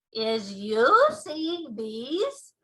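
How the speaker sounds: slow, loud proud giant; BFG giant voice. Acted. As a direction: show off the ears proudly.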